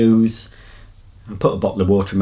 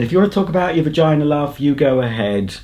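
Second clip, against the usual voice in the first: higher-pitched mock-encouraging retort; cheeky retort; 5 semitones higher than usual. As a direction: retell it as a cheeky go-ahead, pitched up. Retelling own cheeky retort to woman.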